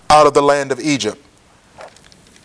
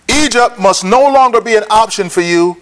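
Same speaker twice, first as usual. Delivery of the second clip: ordinary talk, nothing marked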